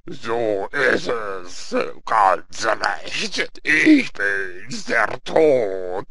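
very slow, drawn-out Death voice; loud, stretched sepulchral declaration; voice from the crypt. Acted. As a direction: announce it grandly from the grave. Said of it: "die Stimme aus der Gruft".

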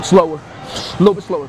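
loud coaching call. Real, not acted. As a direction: call it across the gym. Calling instructions to a lifter.

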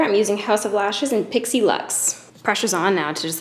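ordinary talk, nothing marked

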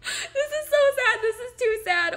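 high, loud laughing sob; over-the-top fake grief; mock anguished cry. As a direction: wail in hammed-up despair. Dongle bad news, mock grief.

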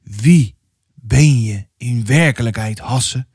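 slow, deliberate interrogation; muttering pastor. A narrator's reading. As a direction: probe the child word by word, slowly. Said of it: "had hij gepreveld". (langzaam prevelende pastoor)

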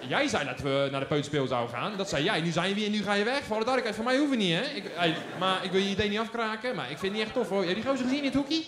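loud indignant protest to mom; a boy telling his mother off, voice up; angry child. Retold or imitated. (boos kind, luid protesterend)

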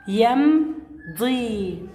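يمضي stretched very slowly with a long final vowel; slow drawn-out word. Shown as a demonstration. Teacher sounding out يمضي slowly to find its root. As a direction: draw out يمضي for the class.

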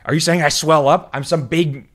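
loud, high, touchy gangster bird; pissed-off wiseguy impression; Joe Pesci. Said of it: "talks just like Joe Pesci".

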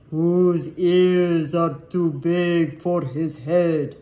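loud, slow riddle-giver; great Sphinx. A narrator's reading. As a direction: intone the riddle slowly and loudly like a Sphinx. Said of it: "the great Sphinx spoke".

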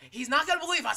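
high, loud mocking repeat. Shown as a demonstration. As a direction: shout "He's not gonna believe us" high. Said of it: "repeat mocking".

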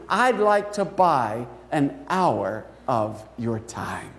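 put-on a child's heartbreaking request, slowly; tender child plea. A narrator's reading. Child's poignant request, slowed.

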